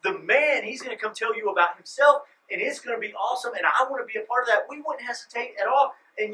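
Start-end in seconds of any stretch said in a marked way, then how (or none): 0.0-4.7 eager boast. Retold or imitated. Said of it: "We'd be like".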